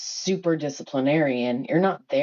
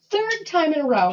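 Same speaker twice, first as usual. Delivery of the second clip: ordinary delivery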